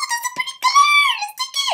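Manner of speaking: high breathy cutesy voice; ditzy squeal. Retold or imitated. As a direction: gush like a dazzled shopper. Ditzy delighted voice.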